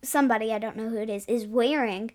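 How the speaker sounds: ordinary delivery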